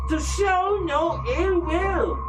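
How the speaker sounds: high, slow, cold noblewoman voice; Lady Amelia. Acted. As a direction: speak as a haughty, grieving matriarch.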